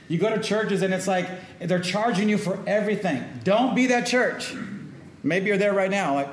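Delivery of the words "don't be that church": high, loud, slow and weighty; emphatic warning. Real, not acted. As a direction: drive the warning home to the church. Preacher urging the congregation.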